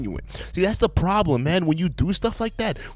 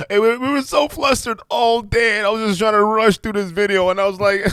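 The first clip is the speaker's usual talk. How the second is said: high-pitched mock apology voice; mocking imitation; 7 semitones higher, 3 dB louder than usual. Reactor mimicking the mother's flustered excuse, high mocking voice. Mocks the apology just played.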